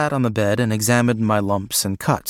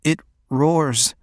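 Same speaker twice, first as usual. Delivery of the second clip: plaintive; 3 semitones higher than usual. A narrator's reading. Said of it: "he said plaintively".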